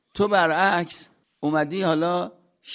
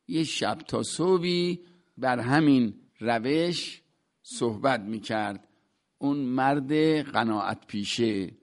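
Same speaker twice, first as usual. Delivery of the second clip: usual speaking voice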